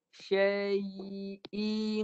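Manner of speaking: "Syai'in" drawn out, ending in a held nasal hum; ikhfa nasalisation. Shown as a demonstration. Tajwid teacher demonstrates ikhfa, slow recitation with nasal hum. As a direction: hide the n of "Syai'in" in a long ng-like hum. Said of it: "Ikhfakan kat pangkal hidung".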